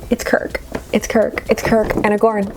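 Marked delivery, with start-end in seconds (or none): 0.1-1.4 giddy whispered reveal. Real, not acted. Vlogger revealing the Kirk figure to viewers, giddy whisper. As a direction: whisper it like a delighted secret.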